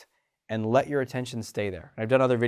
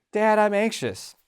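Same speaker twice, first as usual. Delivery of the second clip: put-on small child voice; 8 semitones higher, slower than usual. Kid tells parent feelings.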